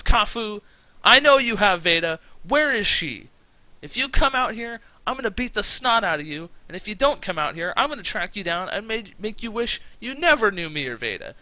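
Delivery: loud, high-pitched angry challenge; angry wrestling promo. Acted. Johnny demanding his kidnapped love back from Kafu, angry shout. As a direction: call out a rival at full promo volume. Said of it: "He's not, he's not happy-go-lucky".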